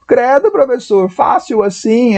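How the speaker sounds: high, drawn-out student disbelief; put-on high-pitched incredulity; incredulous student. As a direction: mimic a student amazed it's this easy. Voices an incredulous student's question.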